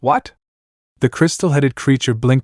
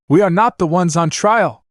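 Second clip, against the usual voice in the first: raised, higher protest; mild shout; 6 semitones higher than usual. Acted. Omnitraxus objecting to the box, mild shout. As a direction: push back with a lifted voice. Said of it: "Omnitraxus shouted".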